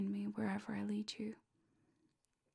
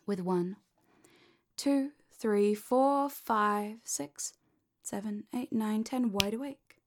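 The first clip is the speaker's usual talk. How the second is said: energizing count-up; 7 dB louder, slower than usual. Acted. Counting listener up out of trance.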